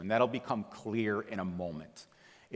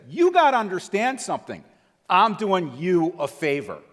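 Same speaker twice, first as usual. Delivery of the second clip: much higher and louder, slowing into a scolding bark; angry rebuke; 8 semitones higher, 10 dB louder than usual. Retold or imitated. Dealer friend snaps at the wife, loud angry scolding. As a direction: jab a finger and scold her. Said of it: "sticks his finger in her face".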